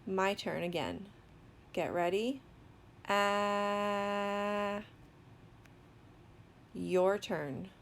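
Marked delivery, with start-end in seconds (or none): 3.1-4.8 "Ah" drawn out long and steady; sustained sound. Shown as a demonstration. Teacher models the sound to learners, sustained. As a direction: hold "Ah" on one level note. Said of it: "I'll keep on saying it".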